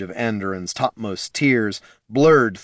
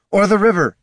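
loud, higher call; 11 semitones higher, 6 dB louder than usual. Acted. Loruna adding to Gorm's order, calling out. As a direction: call it out over the wind.

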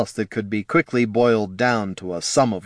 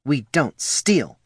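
snarled; 4 semitones higher, slower than usual. A narrator's reading. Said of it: "came out in a snarl".

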